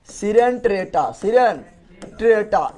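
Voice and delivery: सिरेंट्रेटा very drawn out, part by part; slow dictation of सिरेंट्रेटा, with breaks between parts; slow syllable drill. Shown as a demonstration. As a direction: sound out सिरेंट्रेटा slowly for the class. Drilling the phylum name slowly.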